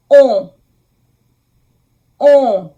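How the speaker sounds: "on" said as a loud, rounded French nasal vowel; nasal on sound. Shown as a demonstration. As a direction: model "on" loudly, with lips forward and through the nose. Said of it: "the last nasal sound".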